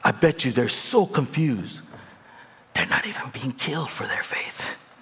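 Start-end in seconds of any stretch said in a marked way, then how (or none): 2.7-4.9 hushed, breathy aside; whisper. Real, not acted. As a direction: lean in and whisper it. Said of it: "whispered aside".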